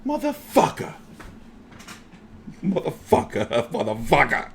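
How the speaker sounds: hushed imitated cursing, fading away; muttered mock curse. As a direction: mutter it to yourself, ending in a laugh. Voicing fighter leaning on ropes.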